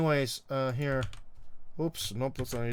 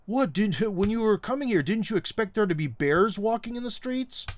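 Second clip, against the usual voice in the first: higher, eager mock questioner; hammed-up incredulous asking, pitched up; tired jokester's question; 7 semitones higher, 3 dB louder than usual. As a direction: imitate someone delivering a stale joke. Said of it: "the same unfunny joke".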